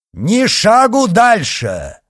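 slow, high, loud shout; commanding, drawn-out yell. A narrator's reading. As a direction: shout to halt a crowd. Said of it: "крикнул коадьютор".

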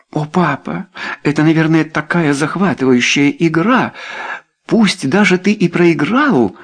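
put-on high-pitched, girlish enthusiasm; thrilled young daughter. A narrator's reading. Daughter Lucinda fascinated by the game, thrilled. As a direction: gush to Papa, fascinated.

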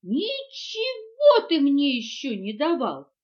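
high, loud, stretched protest; indignant. A narrator's reading. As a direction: protest it, outraged, slowly. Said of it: "возмутилась мартышка". (возмущённо)

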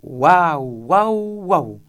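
loud, drawn-out howls, swooping up then down; chorus wail. A narrator's reading. Chorus after the duchess's second verse, howling loudly.

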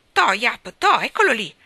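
loud, high call to the dog; boy urging his dog after a water rat; excited shout. Acted. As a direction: yell and point the dog at it. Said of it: "gridò Tom battendo le mani".

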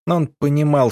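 ordinary delivery, nothing marked

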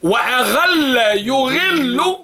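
slow drawn-out teacher prompt; slow drill. Shown as a demonstration. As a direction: stretch the words for the class to answer. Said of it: "drilling forms with students". (نطق ممدود بطيء)

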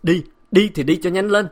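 loud, high dismissal; furious shouted order; angry shout. A narrator's reading. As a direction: yell at her to get out. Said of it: "Tiếng bá Nam hét to".

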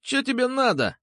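high-pitched tearful whine; whiny wail. A narrator's reading. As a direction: wail it like a whining kid. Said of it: "плаксиво заголосил он". (плаксиво, высоким голосом)

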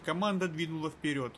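plain reading voice

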